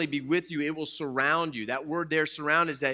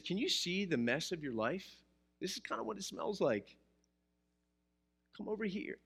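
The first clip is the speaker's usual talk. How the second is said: gentle, much softer invitation; hushed, coaxing, higher; soft mock voice; 4 semitones higher, 8 dB quieter than usual. God speaking softly to a sinner, hushed and higher. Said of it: "God says".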